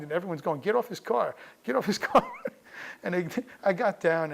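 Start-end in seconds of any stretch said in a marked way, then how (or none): 0.6-2.2 high-pitched imitation of an alarmed crowd; crowd calling out. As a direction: call it out high, breaking into a laugh. Crowd calling at striker, retold.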